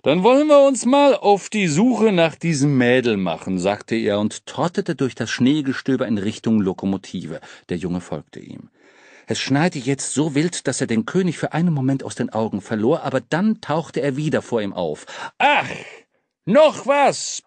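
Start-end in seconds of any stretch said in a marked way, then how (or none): 0.0-3.6 hearty king. Acted. King sets off briskly. 15.4-17.4 bellowed over shoulder. Acted. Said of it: "brüllte der König über seine Schulter".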